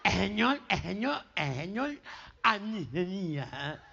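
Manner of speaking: put-on low, nasal, sobbing gangoso boy; mock crying. Boy explains to his mother, mock-tearful gangoso voice. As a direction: blubber through the nose in broken, repeated bits. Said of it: "el nene llega llorando".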